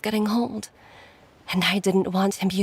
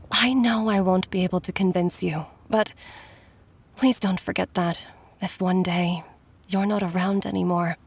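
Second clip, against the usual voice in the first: ordinary talk, nothing marked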